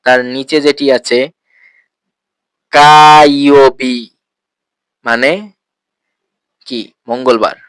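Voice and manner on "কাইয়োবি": কাইয়োবি stretched very slowly and louder for learners; drawn-out word. Shown as a demonstration. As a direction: spell out কাইয়োবি slowly, syllable by syllable. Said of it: "teaching day names".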